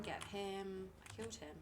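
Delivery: ordinary talk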